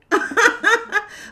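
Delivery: high-pitched burst of laughter; real giggling burst; amused laugh. Real, not acted. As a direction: crack up at your own reply. Laughs at own imagined reply.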